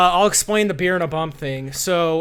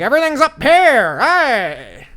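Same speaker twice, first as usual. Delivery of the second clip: high, loud prospector holler; hee-haw prospector; 10 semitones higher, 9 dB louder, slower than usual. Acted. Host imitating dad, hollering hee-haw prospector voice. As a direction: crow it like an old gold-rush prospector. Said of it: "it's like a hee-haw prospector voice".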